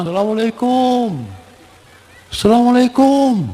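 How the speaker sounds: high-pitched hailing greeting; calling out greeting. Acted. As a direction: act a husband announcing he's home. Husband calls out arriving home.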